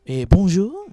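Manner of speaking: put-on loud French-accent bonjour; hotel clerk greeting in French. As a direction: greet like a Paris receptionist. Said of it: "they were just like".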